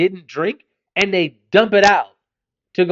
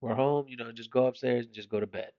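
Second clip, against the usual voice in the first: much softer, low and tender; gentle wake-up; 7 semitones lower, 13 dB quieter than usual. Retold or imitated. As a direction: coax a sleeping kid awake softly. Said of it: "I'm just like".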